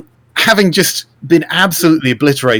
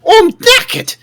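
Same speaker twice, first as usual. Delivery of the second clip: tired goblin voice; 14 semitones higher, 4 dB louder than usual. Acted. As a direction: whine like a knackered goblin. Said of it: "you basically say".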